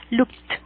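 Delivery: "Looked" in one syllable, lookt; "Looked" with a silent e. Shown as a demonstration. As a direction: end "Looked" on a released t. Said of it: "va a sonar como una T".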